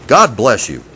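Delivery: ordinary talk, nothing marked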